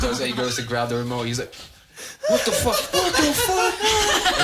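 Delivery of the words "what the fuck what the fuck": high, loud shout of disbelief; imitated outburst, stretched out at the end; mock outraged yell. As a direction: yell it like a stunned prank victim. Acts friend finding remote glued.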